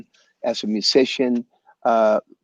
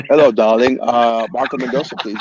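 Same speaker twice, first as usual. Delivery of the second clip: mock posh caller, louder and put-on; prank caller voice; 4 dB louder than usual. Caller teasing Marco on the phone, mock posh voice. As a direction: put on a fake smooth telephone voice. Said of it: "The, the typical".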